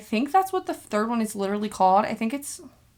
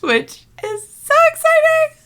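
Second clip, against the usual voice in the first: much higher, squealing with joy; excited squeal; 5 dB louder than usual. Real, not acted. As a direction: squeal it with delight. Announcing engagement to viewers.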